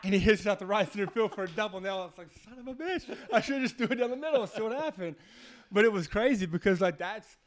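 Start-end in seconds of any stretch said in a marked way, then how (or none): 2.5-5.2 laughing. Retold or imitated. Retold frustrated curse, laughing.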